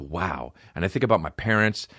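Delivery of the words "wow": softer, awed wow; hushed wow. Retold or imitated. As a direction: take in the stage. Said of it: "I'm like".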